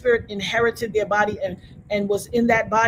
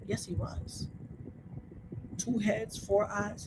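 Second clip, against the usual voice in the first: soft breathy whisper; whispered insistence to the viewers; 12 dB quieter, slower than usual. Real, not acted. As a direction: mutter it under the breath. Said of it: "unvoiced aside".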